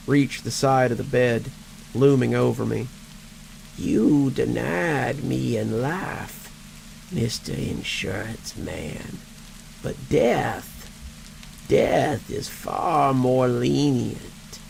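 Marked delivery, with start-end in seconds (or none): 3.8-14.6 slow, rasping dead man; undead voice. Acted. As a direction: drag each word out like a corpse. Ezekiel's undead voice.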